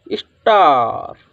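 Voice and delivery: "स्टार" with a drawn-out falling vowel; model pronunciation. Shown as a demonstration. Teacher sounds out the word for students, "स्टार" drawn out. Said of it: "तो इसकी ध्वनि क्या निकलेगी".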